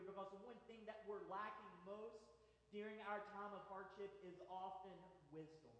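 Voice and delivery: usual speaking voice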